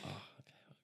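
hushed whisper to himself; barely voiced, under the breath; whispering alone in the toilet. Whispering at the urinal.

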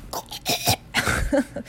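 strangled voiceless choking; choking noises. Acted. Aunt reads the letter's first line, choking. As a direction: gag as if clutching your throat. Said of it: "made choking noises".

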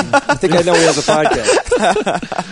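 sarcastic high laugh through words; laughing sarcasm. Real, not acted. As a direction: tease them, laughing. Joking sarcastic aside, laughing.

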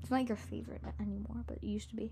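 hushed aside; whispering. Real, not acted. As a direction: whisper it behind her back. Confiding aside about friend's favorite.

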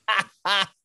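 loud, high burst of laughter; real amused cackle. Real, not acted. Amused by host's joke about accent.

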